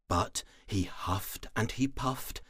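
ordinary delivery

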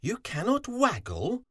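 very high, rising disbelief; incredulous high-pitched question; stunned fox. A narrator's reading. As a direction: ask it in astonishment. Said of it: "Fox was stunned".

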